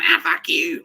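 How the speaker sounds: a half-hearted imitation of the shouting neighbor; mock neighbor yell. The neighbor swearing next door, mock yell. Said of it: "the neighbor going".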